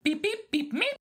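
high droid beeping; droid beeps. Acted. R2-D2 beeping back at the golden droid, chirpy. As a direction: chirp like a little robot.

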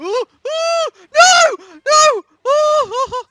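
very high loud panicked squeals. Real, not acted. Gamer caught out as gum fails, high shrieks. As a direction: shriek in alarm.

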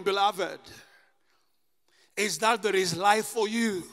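normal talking voice

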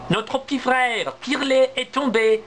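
high, urgent squirrel cries; frightened squirrels. Acted. Little squirrels cry down to Niels, high and urgent. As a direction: squeak out the alarm. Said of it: "répondent les écureuils".

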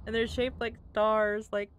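ordinary talk

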